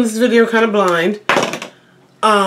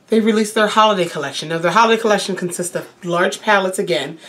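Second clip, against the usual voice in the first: pressured rushing speech; faster than usual. Real, not acted. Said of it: "talking a mile a minute".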